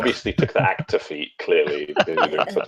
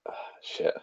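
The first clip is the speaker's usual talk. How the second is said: whispered curse; 11 dB quieter than usual. Real, not acted. Quiet curse after losing the hag.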